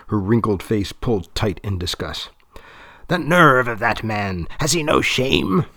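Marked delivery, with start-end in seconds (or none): 3.1-5.6 put-on high, reedy outraged old woman; reedy and scandalized; thin reedy voice. A narrator's reading. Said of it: "in her sharp, reedy voice".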